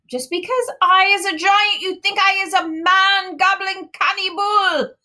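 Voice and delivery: high, loud giant voice; shouting giant. A narrator's reading. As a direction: bellow it as the giant. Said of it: "he shouted".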